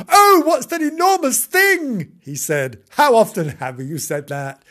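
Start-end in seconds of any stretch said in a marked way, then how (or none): 0.1-2.2 high, loud, drawn-out wail; frightened kitten. Acted. Kitten seeing a motor car, frightened. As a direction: wail in hammed-up alarm. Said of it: "frightened poor Timbo".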